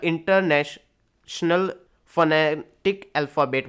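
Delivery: "International phonetic" drawn out piece by piece; slow, broken-up reading. Shown as a demonstration. Teacher expanding an abbreviation, slow and segmented. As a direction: slowly break up each long word, then carry on. Teacher spells out IPA slowly.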